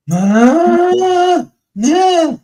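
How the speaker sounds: high, loud retold shriek of shock; freaked-out shriek. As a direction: squeal it like you just saw the bill. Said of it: "And I freaked".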